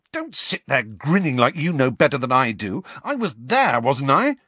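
heated. A narrator's reading. Harry to his grinning friends, heated. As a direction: throw it back at them. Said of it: "he said heatedly".

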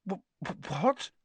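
high, halting stammer. A narrator's reading. Harry shocked by Dobby's warning, stammering. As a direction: trip on the word in disbelief. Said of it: "Harry stammered".

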